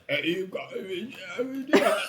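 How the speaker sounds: high garbled babble through laughter; mock incoherent chatter; unintelligible rambling guy. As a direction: imitate someone talking nonstop, impossible to follow. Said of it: "I couldn't get anything he was saying".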